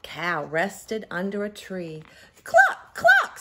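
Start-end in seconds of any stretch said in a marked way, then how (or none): 2.4-3.4 very high, loud hen cluck; clucking cow. Acted. Teacher voicing Cow for her class, high loud clucking. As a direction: cluck like a chicken. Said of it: "said Cow".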